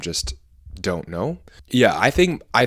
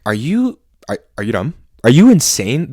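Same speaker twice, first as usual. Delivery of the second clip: raised, disbelieving questions; incredulous retort; 3 semitones higher, 6 dB louder than usual. Retold or imitated. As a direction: challenge them, louder and higher on the last question. Acted retort to Twitter critics.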